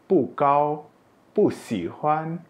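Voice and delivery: pu falls from high before kau and si huan; fourth-tone pu. Shown as a demonstration. Teacher repeating examples, pu in falling fourth tone. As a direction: drop pu sharply, Mandarin falling tone. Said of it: "dibaca dengan nada empat".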